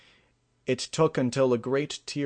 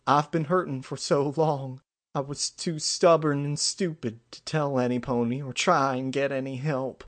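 put-on higher, sorrowful country-kid voice; young country filly confessing; 4 semitones higher than usual. A narrator's reading. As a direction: admit it regretfully, pitched up.

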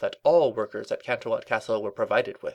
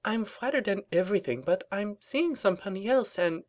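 high prim lady voice; 10 semitones higher than usual. Acted. Rarity awkward let-down.

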